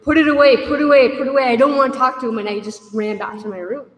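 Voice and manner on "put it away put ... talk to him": loud, high, frantic yell; desperate shouted plea; screaming. Retold or imitated. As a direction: yell it at the officer in a panic. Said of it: "I started screaming at the correctional officer".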